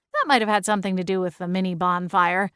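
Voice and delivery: usual speaking voice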